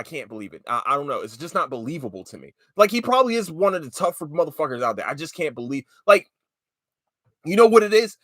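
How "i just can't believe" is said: normal talking voice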